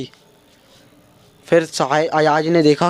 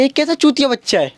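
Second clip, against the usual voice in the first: exasperated disbelief; 4 semitones higher than usual. Retold or imitated. Exasperated at overdressed friend.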